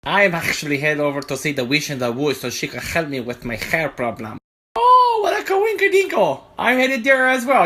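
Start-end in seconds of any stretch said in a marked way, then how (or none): 4.8-7.7 very high, loud falsetto whoop; excited squeal. Acted. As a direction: squeal it with delight at the coincidence. Sheep man excited coincidence.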